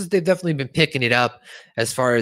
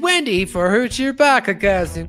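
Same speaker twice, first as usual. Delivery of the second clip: high, put-on announcer impression; Mister Garrison; 9 semitones higher, 4 dB louder than usual. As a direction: announce the winner in a higher cartoon voice. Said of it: "Mister Garrison says".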